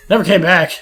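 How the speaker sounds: usual speaking voice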